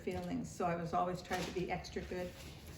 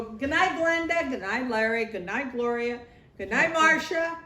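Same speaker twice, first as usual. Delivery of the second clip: calling good night; 5 semitones higher, 7 dB louder than usual. Retold or imitated. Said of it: "Everybody would go".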